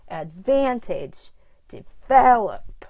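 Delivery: advantage and develop, punching VAN and VEL; slow adVANtage, deVELop with loud stressed syllables; punched word stress. Shown as a demonstration. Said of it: "I punch every time I say the stress word".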